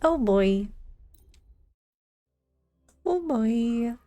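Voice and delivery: ordinary delivery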